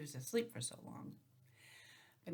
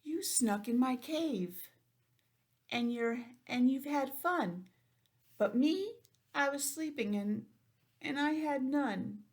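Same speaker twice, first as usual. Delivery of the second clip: higher, slow, wounded complaint; whiny sad bear; 6 semitones higher, 4 dB louder than usual. Acted. Bear whining to the animals who partied without him. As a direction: plead like a hurt, left-out bear.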